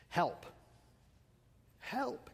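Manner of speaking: hushed, understated cry for help; mock scream. Houseguest screaming at two in the morning, muted mock scream. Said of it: "begins screaming with blood-curdling screams".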